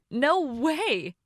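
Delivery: high, emphatic refusal; appalled disbelief. A narrator's reading. Bridesmaid hearing she'll be godparent with him, appalled.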